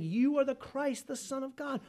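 high-pitched proclamation; demons crying out. Acted. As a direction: cry it out as the demons. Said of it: "The demon shouts out".

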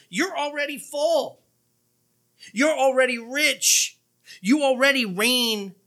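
high, drawn-out mock congratulation; dripping sarcasm. Preacher reads Paul to the Corinthians, dripping with sarcasm. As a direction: praise them high and slow, not meaning it. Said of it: "with that sarcasm".

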